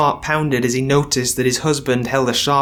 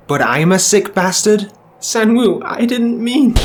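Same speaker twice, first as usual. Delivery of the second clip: higher, louder panicked backpedal; Bum, panicked; 7 semitones higher, 3 dB louder than usual. Acted. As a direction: scramble to take it back.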